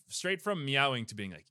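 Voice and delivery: ordinary talk, nothing marked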